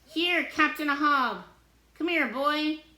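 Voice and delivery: loud, high calling for a pet. A narrator's reading. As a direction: call the cat over. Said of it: "he yells".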